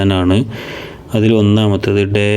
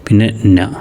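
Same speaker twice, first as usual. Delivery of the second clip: ordinary delivery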